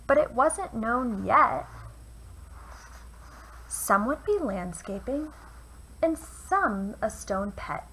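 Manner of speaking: put-on lively read-aloud for children; storyteller voice for kids; picture book reading. A narrator's reading. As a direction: read it like bedtime rhymes. Reads a rhyming picture book.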